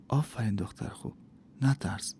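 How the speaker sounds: soft, hushed encouragement; gentle. A narrator's reading. Young woman calming the frightened girl, soft and gentle. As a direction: soothe a scared girl. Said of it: "به آرومی گفت".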